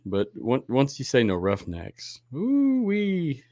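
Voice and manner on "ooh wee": excited whoop. Real, not acted. Reacting to Roughnecks news.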